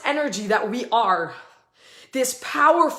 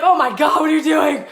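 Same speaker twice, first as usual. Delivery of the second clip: louder, mock-horrified yell at a friend; mock shocked shout; 2 semitones higher, 6 dB louder than usual. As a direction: pretend to be shocked at her antics. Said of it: "She'd just be like".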